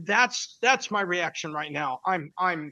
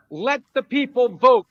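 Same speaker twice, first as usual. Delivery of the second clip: loud; 5 semitones higher, 9 dB louder than usual. Real, not acted. Heated political rant.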